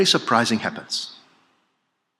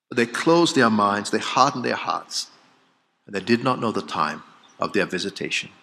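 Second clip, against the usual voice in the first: usual speaking voice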